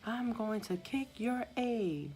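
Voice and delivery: high, slow mock threat in a changed voice; imitating a husband warning the nurse off; threatening husband. Husband threatening the nurse over his wife, slow mock threat. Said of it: "tells me".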